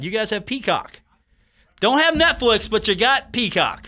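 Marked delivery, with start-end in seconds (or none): 1.8-3.9 higher, louder incredulous jab; mock disbelief at the studio; surprised. Real, not acted. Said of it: "Wow, okay. Was not expecting that".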